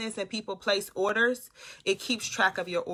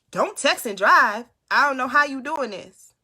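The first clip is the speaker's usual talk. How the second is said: raised, higher voice, slowing on the warning; loud scolding; 4 semitones higher, 8 dB louder than usual. Real, not acted. Streamer to a viewer texting at the wheel, loud scolding. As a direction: scold them loudly out of concern.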